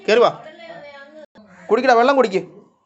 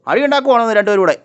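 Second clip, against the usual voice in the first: louder, higher telling-off; loud scolding; 6 semitones higher, 9 dB louder than usual. Real, not acted. Owner scolding two dogs for fighting, loud and high. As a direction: break up the dogs' fight with your voice.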